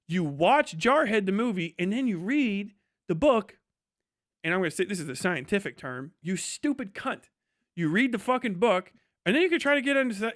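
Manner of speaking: usual speaking voice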